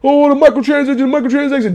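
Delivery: high, mocking whine of the complainers; mocking whiny complainers. Mocking gamers who whine about microtransactions, in a high sneering whine. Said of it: "I told y'all, dumbass".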